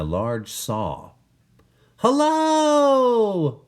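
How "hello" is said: high, loud calling out; yell. Acted. Walter calling down to the woodcutters, loud high yell. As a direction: call down from the treetop. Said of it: "Walter yelled out".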